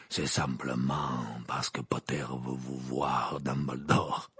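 low, very slow growl; growling Maugrey. A narrator's reading. Old Auror telling Dumbledore of a visitor, growling. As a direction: growl the news out unhurriedly. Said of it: "grogna Maugrey".

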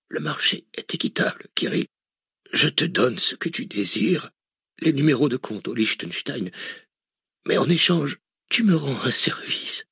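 put-on a frail old man driving a bargain; weak dying old man. A narrator's reading. Dying billionaire offers Cortès a deal, frail whispery voice.

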